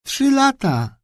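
put-on girl obediently echoing grandpa. A narrator's reading. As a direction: repeat it dutifully like an echo. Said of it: "powtórzyła jak echo Priscilla".

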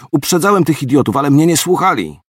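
mild outburst. Acted. Sergeant defending himself to his officer, mildly heated. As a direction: vent it, lightly heated. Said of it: "wybuchnął Kasjanow".

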